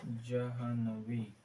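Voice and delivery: जाह्नवी slowly, half ह joined to व; slow careful pronunciation. Shown as a demonstration. Teacher demonstrating correct spelling to class, slow and careful. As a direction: stretch जाह्नवी out, ह before न clearly. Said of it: "आधा हा वा".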